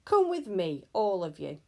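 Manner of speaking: put-on old teacher scaring the children into line; loud frightening voice. A narrator's reading. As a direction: bark the command to frighten them. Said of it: "in such a loud and frightening voice".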